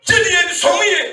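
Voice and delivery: shouted, slow preaching call; fiery sermon shout. Acted. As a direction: proclaim it to the whole church.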